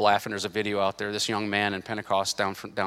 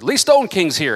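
higher, louder announcer's call; announcer introduction; 6 semitones higher, 8 dB louder than usual. Retold or imitated. Announcer presenting a preacher to the crowd, raised excited call. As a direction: call out the guest's arrival. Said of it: "and they said".

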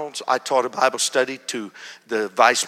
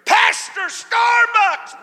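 loud, high, drawn-out call; calling out across a room; 7 dB louder, much slower than usual. Retold or imitated. David calls his pastor across Starbucks, loud drawn call. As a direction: shout his name over the crowd.

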